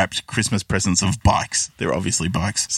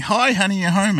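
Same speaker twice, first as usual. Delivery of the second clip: Joy's eager greeting; 11 semitones higher than usual. Retold or imitated. Ex-wife greets Earl as if he's home, high eager mock voice. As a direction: gush it like an overexcited wife. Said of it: "Joy sort of runs in saying".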